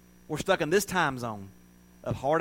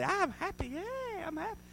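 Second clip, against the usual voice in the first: much higher squeaky soft strained cheer; forced fake happiness; 8 dB quieter than usual. Mocking always-happy people, even more forced chirp. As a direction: push the fake smile even harder. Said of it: "The next day".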